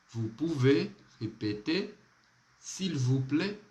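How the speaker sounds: vous pouvez répéter spoken slowly with a rising question tune; slow model phrase. Shown as a demonstration. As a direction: say it slowly for the class to repeat. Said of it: "Pratique então".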